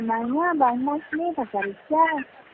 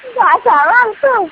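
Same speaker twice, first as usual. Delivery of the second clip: much higher, much louder disbelief; shocked shout; 11 semitones higher, 14 dB louder than usual. Real, not acted. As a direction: blurt it out in shock. Reacting to request for a kiss.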